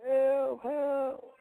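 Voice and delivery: high, drawn-out plea; eerie mock call; distant cry for help. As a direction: imitate a far-off voice calling for help. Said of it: "hearing somebody go".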